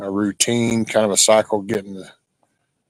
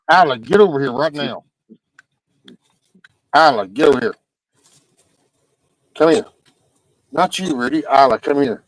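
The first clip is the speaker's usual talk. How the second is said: yelling at dog; 4 semitones higher, faster than usual. Real, not acted. Calling back the dog.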